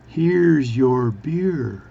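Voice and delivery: loud, laboriously stretched words; really slow speech. Acted. Bartender serving the Newfie, really slowly. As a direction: draw each word out for comic effect. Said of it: "is speak really slowly".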